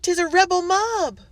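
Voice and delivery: high, loud, panicked servant's cry; frightened cry. A narrator's reading. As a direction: cry out the alarm in terror. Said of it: "she cried".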